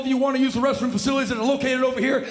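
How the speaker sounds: high, loud, fast military cheer; gung-ho airman. Acted. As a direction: pump up the arrivals.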